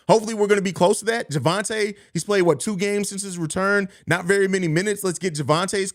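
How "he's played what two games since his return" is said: ordinary talk, nothing marked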